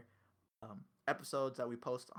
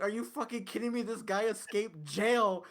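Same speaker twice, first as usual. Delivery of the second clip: loud exasperated disbelief, higher; incredulous rant; 6 semitones higher, 6 dB louder than usual. Retold or imitated. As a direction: huff it out, outraged and incredulous. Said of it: "I'm like".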